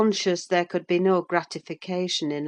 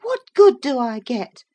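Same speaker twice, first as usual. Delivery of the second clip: high, louder, exasperated; more irritated, higher and louder; growing irritated; 8 semitones higher, 6 dB louder than usual. A narrator's reading. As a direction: throw the questions at him. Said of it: "growing more irritated".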